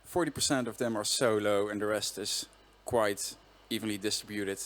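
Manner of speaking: usual speaking voice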